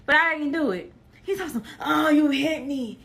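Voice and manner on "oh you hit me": slow, hammed-up fake whimper; mock crying. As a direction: imitate a man faking being hurt. Mocking man faking injury.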